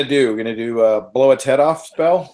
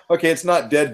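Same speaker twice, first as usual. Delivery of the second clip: ordinary talk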